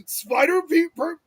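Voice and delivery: high-pitched imitated cartoon yell; Homer's yelling. Podcaster quoting the spider spray clip, mock yelling. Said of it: "he starts yelling".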